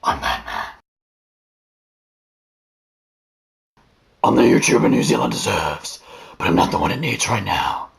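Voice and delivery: low whispered growl; Batman voice. Pretend. Batman impression.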